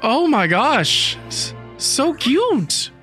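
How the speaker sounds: high, stretched-out squee; overwhelmed by cuteness, voice way up; gushing. Real, not acted. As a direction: melt slowly into a high coo. Gushing over cute character.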